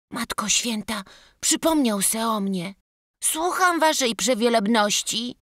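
put-on high childlike voice, eager and startled; the boy jumps up to answer his master; boy answering aloud. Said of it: "rzekł głośno".

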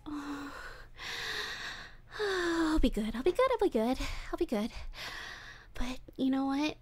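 creepy lovesick voice, drifting into breathy whispers; obsessive captor. Acted. Captor confesses love to tied-up Wolfie, creepy and hushed. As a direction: confess your obsession to your captive, unhinged.

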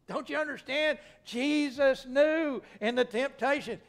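high, loud, urgent pulpit cry; full-force preaching. Real, not acted. Preacher explaining Jesus in the temptation, full-force preaching. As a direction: drive it home to the congregation, stretching words.

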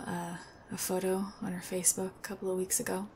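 ordinary talk, nothing marked

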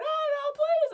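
very high, loud wail; shrieked, tearful begging; screaming and crying. Acted. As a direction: wail in hammed-up terror. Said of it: "I'm screaming and I'm crying".

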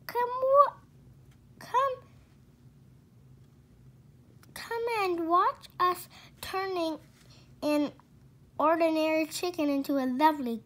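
high, eager, rushing; excited. Acted. Father calling wife to watch the chicken, excited.

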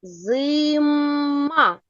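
Зима with a long hummed М; third sound drawn out. Shown as a demonstration. Teacher cueing the third sound, М stretched in Зима. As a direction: hum on the М in Зима before releasing the А. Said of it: "Який третій звук у слові зима?".

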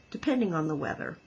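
fast throwaway tag; quick aside. Acted. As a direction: rattle it off quickly. Goose adds quick aside.

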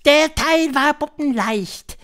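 high, loud witch voice; high-pitched, theatrical witch leader; Grand High Witch. A narrator's reading. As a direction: lecture the coven in a high, imperious voice.

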